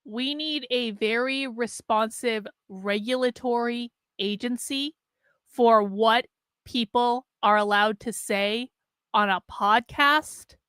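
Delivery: slow, halting, disbelieving echo; incredulous repetition. Retold or imitated. Host echoes guest's regulatory agency idea, slowly incredulous. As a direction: spell it out slowly, pausing between phrases.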